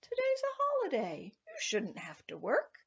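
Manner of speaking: high, sugary leprechaun voice; a sly little man coaxing sweetly. A narrator's reading. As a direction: sweet-talk him in a lilting high voice. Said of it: "smiled very sweetly and said".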